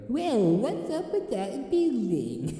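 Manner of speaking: high, slow, slurred sly voice; slurrier conscience voice. Acted. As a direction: slur it like a smug tempter. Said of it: "he sounds, like, slurrier".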